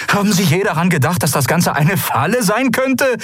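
high, agitated outburst; heated accusation. Acted. Kai accuses the admiral of ignoring a trap, agitated.

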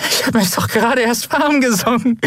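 high, worn-out protest; exhausted high-pitched quip; strained bravado. A narrator's reading. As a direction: protest cheekily though utterly spent. Exhausted singer protests, giggling.

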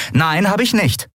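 high, indignant denial; defiant retort. A narrator's reading. Captain answering her engineer's challenge, defiant.

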